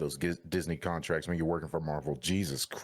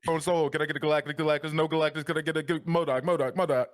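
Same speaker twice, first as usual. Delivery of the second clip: rapid high auctioneer patter; 8 semitones higher than usual. Pretend. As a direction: rattle it off like an auctioneer. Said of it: "That sound like an auctioneer out here".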